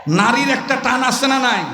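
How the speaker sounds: loud shouted slogans; rallying cry. Acted. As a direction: whip the crowd into a chant. Rallying chants at full force.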